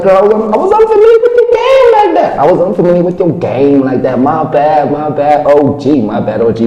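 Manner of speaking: high, loud, squeaky put-on voice; to the DJ after her hip hop set, gushing; playful apology. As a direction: gush an apology to a star. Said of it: "I was like".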